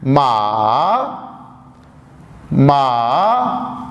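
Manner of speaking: मा dipping low then rising; third tone ma. Shown as a demonstration. Teacher demonstrating the third tone, dipping मा. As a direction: drop मा low, then lift it. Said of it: "और तीसरी tone है".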